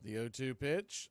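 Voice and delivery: usual speaking voice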